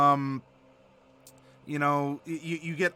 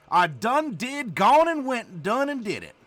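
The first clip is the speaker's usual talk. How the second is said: put-on brisker Southern accent; 5 semitones higher than usual. Streamer repeating the verbs faster in a put-on Southern accent. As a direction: rattle it off like a country storyteller. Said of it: "With this here accent".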